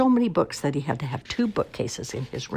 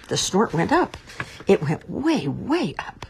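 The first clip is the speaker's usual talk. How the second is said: plain reading voice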